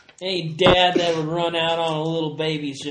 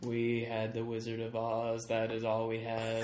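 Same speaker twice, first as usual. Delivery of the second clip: flat Kansas voice; 7 semitones lower, 11 dB quieter than usual. Shown as a demonstration. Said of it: "my impression of every person from Kansas".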